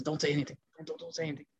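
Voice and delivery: low, very soft repeated hush. Retold or imitated. Retold hushing of angry Umar.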